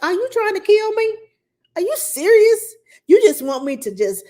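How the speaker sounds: high, loud incredulous retort; indignant disbelief. Retold or imitated. Guest retorting to friend over the salad, indignant disbelief.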